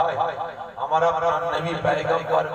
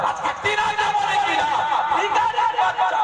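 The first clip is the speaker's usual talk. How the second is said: high, scornful taunt; mocking shout; 13 semitones higher than usual. Real, not acted. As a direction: fling the insult up high. Preacher shouting insult mockingly. (উঁচু গলায় ব্যঙ্গ)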